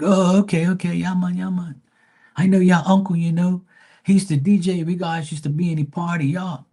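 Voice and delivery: high, loud Bermudian slang banter. Retold or imitated. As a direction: play the friend hollering in recognition.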